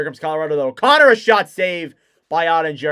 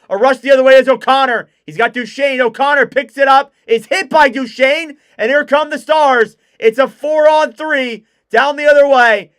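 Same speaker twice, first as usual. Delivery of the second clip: loud, higher, urgent rush call; excited play-by-play; 6 semitones higher, 7 dB louder than usual. Real, not acted. Commentator calling the Stars' four-on-three, excited. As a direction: ride the rush with a raised voice.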